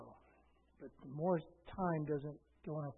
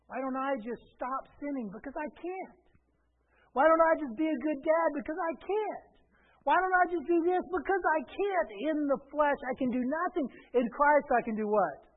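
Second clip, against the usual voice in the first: high, impassioned question-and-answer preaching; full-force preaching; 9 semitones higher, 8 dB louder than usual. Real, not acted. Pastor confessing helplessness to congregation, full-force preaching. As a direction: cry out the questions, then answer them.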